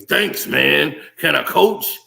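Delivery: hammed-up street hustler pleading to coach; mock street guy. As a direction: play a swaggering guy begging for a coaching job. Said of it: "They didn't just come off the street like".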